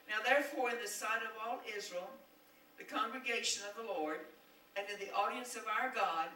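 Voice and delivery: loud, declaiming preacher's reading; scripture read with force. Acted. Preacher reading scripture to the congregation, declaiming loudly. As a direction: proclaim each line to the congregation.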